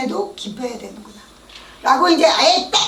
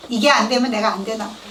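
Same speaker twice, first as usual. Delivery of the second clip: ordinary talk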